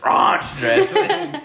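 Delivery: loud, high mock-British "ranch dressing"; boss's British accent. Imitating the boss saying ranch dressing, exaggerated British accent. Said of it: "so when he says ranch dressing".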